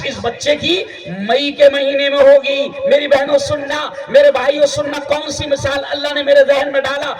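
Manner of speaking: impassioned sermon shout; fiery preaching. Acted. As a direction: rouse the congregation. Preaching at full force.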